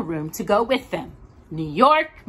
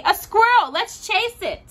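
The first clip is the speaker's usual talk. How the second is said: put-on much higher, louder excited child; excited Daisy; 11 semitones higher, 4 dB louder than usual. A narrator's reading. Daisy urging Josephine to chase the squirrel, excited. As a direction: burst out at the squirrel.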